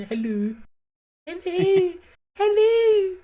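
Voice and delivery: high, fluting, drawn-out hellos climbing upward; Mrs Doubtfire impression. Joking between co-hosts, high Mrs Doubtfire greeting. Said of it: "trying to do Missus Doubtfire".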